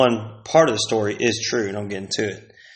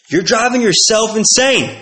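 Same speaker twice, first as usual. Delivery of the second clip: usual speaking voice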